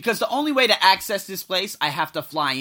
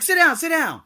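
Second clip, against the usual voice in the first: high, louder quick invitation; eager host urging; 11 semitones higher, 6 dB louder than usual. Retold or imitated. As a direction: wave a guest in with your voice. Acts host urging him to sit.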